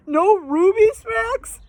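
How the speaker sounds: high, slow dog-speak whine; Scooby-Doo voice. Acted. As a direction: drawl it like Scooby.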